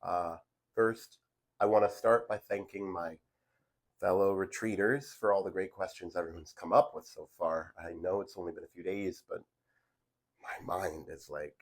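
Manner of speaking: normal talking voice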